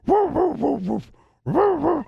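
high, sharp barking dog imitation; imitating dog barks. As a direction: bark like the dog, yappy and high-pitched. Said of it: "All of a sudden I hear".

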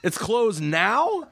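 high, slow mock innocence; mock confusion; fake surprise. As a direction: play dumb, laughing. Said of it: "And we're like".

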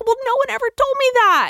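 high, fast indignant protest; fast high pitched complaint. Retold or imitated. Arguing with boss.

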